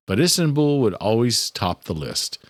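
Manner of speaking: ordinary delivery, nothing marked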